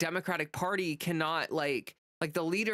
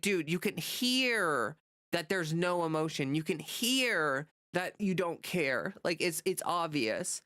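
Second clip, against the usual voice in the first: usual speaking voice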